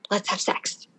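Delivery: breathy whispered invitation to her husband; hushed, playful suggestion; retold sultry whisper. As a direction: whisper it like a come-on. Propositioning husband in retold scene.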